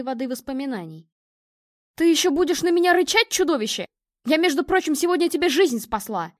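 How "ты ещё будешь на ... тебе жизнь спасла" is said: loud, higher outburst at the dog; indignant. A narrator's reading. Girl scolding the growling dog she rescued, indignant. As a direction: scold it in raised, offended tones. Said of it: "возмутилась Лиза".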